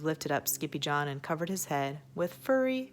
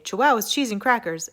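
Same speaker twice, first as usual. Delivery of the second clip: exclamation; 6 semitones higher, 7 dB louder than usual. Acted. Exclaimed quick phrase.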